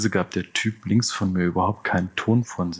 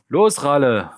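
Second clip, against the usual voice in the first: loud, high call across the train; rowdy leader yelling a name; shouted call; 6 semitones higher, 6 dB louder, slower than usual. Acted. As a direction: bark it out to your buddy. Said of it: "rief der Anführer".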